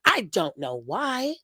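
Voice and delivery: usual speaking voice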